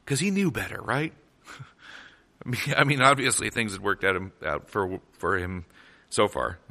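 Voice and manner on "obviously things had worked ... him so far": low, dry sarcastic aside; sarcasm. Real, not acted. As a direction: say it drily, not meaning a word. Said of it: "That's sarcasm".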